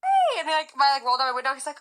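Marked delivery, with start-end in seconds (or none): 0.0-0.4 high, breezy "Hey!" from him, less loud; ex's hey. Retold or imitated. Her ex answering her greeting, higher and casual. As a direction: toss back a casual greeting.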